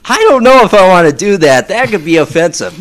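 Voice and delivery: high, loud, hurried objection; agitated retold protest. Retold protest to sparkly unicorn request.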